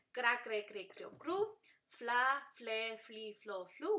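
"Cra, cre" series as a rhythmic vowel run; each cluster like "fla" as one unbroken beat; syllable drill. Shown as a demonstration. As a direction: recite "fla, fle, fli, flo, flu" for learners to repeat. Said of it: "Ustedes también repitan".

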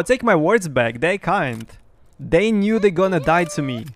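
usual speaking voice

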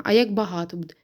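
usual speaking voice

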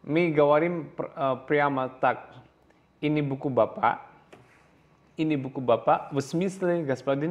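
ordinary delivery

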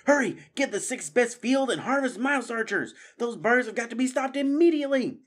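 high, loud rallying orders; urgent command. Acted. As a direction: shout orders in an emergency. Matthias takes command, urgent orders.

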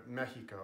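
Mexico said as MEH-hee-ko; native pronunciation. Shown as a demonstration. Friends having fun naming places, native pronunciation. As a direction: say Mexico the Spanish way, with an h sound. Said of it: "in their native languages".

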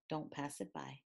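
plain reading voice

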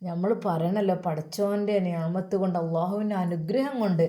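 usual speaking voice

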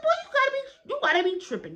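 high-pitched mock disbelief; incredulous comeback. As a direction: push it up high as an outraged retort. Retold comeback to the teacher.

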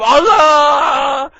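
loud war cry, drawn out; battle cry. Acted. Hero charges his enemy, roaring battle cry.

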